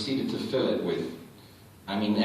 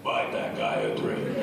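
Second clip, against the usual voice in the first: Andre voice; 11 semitones lower than usual. Retold or imitated.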